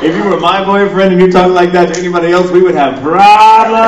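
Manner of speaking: high, loud sassy woman; big comic warning, stretched at the end; mock scolding. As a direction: imitate a woman loudly warning a boyfriend. Imitating the woman's loud reaction.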